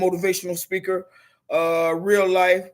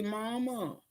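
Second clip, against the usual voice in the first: soft, small Mama,; youngest in crisis; 2 semitones higher, 11 dB quieter than usual. Retold or imitated. Youngest daughter in crisis calling mother, soft and small. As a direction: say Mama, quietly like a child in trouble. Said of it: "if she's in a crisis, she say".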